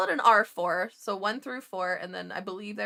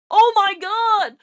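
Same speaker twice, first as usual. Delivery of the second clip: loud, much higher delighted cry; excited shout; 12 semitones higher, 12 dB louder, slower than usual. Real, not acted. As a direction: burst out at the memory of the food. Raving about nurses' potluck food.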